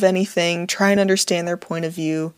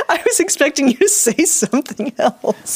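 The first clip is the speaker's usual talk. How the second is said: amused; 8 semitones higher than usual. Real, not acted. Laughing at partner's sign-off.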